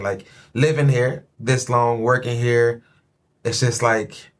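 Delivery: ordinary talk, nothing marked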